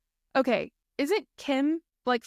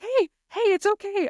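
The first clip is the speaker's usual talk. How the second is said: calm her down gently, pitched up high; soothing husband; 9 semitones higher than usual. Retold or imitated. Husband apologizing to his frightened wife, mock soothing. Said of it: "he was like".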